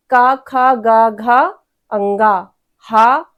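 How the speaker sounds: का, खा, गा, घा, अंगा, हा each said apart from the throat; clear throat sounds. Shown as a demonstration. Teacher models the का वर्ग for students to repeat, articulated. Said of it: "फिर कावर करिए".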